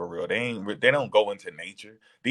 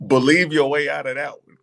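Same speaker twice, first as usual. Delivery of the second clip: loud; 6 semitones higher, 7 dB louder than usual. Real, not acted. Loud challenge amid laughter.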